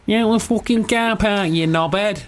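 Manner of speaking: hammed-up Manchester insult, drawn out; Manchester accent. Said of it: "That sounded like Manchester at the end".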